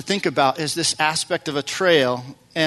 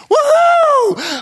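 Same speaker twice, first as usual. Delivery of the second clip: high, loud triumphant woohoo; joyful whoop; 8 dB louder than usual. Retold or imitated. Rider cheers after making it through the rocks, whooping high and loud. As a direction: let out a whoop of victory.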